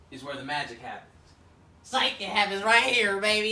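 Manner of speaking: ordinary talk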